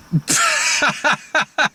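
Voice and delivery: high pitched laugh; laughing at the punchline. Real, not acted. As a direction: laugh hard at the joke.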